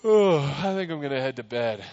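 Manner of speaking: lightly voiced lazy reply; a hint of shrugging off danger; casual yawn-like dismissal. Lot casually ignoring his warning.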